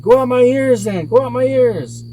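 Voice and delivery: high, loud desperate wail; monster in pain. A narrator's reading. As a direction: yell it out as the hurting monster. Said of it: "thinking really quickly and in pain".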